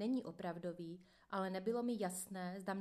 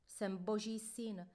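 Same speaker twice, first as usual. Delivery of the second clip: plain reading voice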